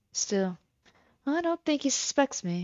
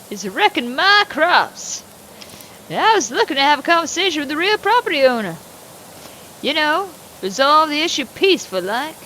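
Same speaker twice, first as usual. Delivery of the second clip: put-on a loud, higher-pitched cantankerous old farmer; gruff old farmer; 4 semitones higher, 5 dB louder than usual. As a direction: play the surly old landowner, talking down to the neighbor. Plays Mister Morgan.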